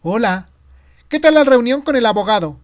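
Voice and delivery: high, loud woman's voice on a call; eager, thrilled questioning; excited. A narrator's reading. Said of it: "Parecía emocionada". (voz de mujer emocionada)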